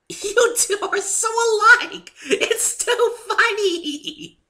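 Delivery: very high, loud, slow laughing squeal; howling with glee; laughing Pinky. A narrator's reading. Pinky laughs at the narrator and Fluttershy, very high and loud. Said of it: "burst into a fit of laughter".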